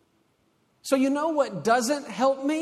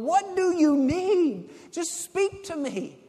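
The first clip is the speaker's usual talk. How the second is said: put-on high, slow, drawn-out baby-talk coaxing; parent over a crib; 7 semitones higher, 4 dB louder, slower than usual. God over the crib to his child, coaxing baby talk. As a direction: coax a baby, stretching each word. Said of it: "the same way I did with my little boy".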